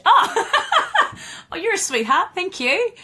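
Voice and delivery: high, laughing through the thanks; flattered laugh. Real, not acted. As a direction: giggle as you take the compliment. Flattered by a viewer's compliment.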